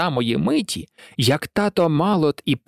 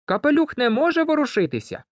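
plain reading voice

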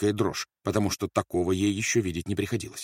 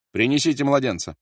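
raised, higher-pitched order; commanding; 7 semitones higher, 7 dB louder than usual. A narrator's reading. Officer orders the baby brought in, commanding. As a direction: call out a command.

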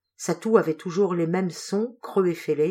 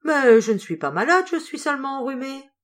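louder, higher indignant protest; 8 semitones higher, 6 dB louder than usual. A narrator's reading. Sick seamstress to her employer, loud protest. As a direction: brush off the worry, loudly. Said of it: "Sandrine se récria".

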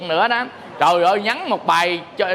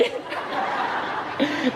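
soft airy laugh; 5 semitones higher, 7 dB quieter than usual. Real, not acted. Airport story punchline.

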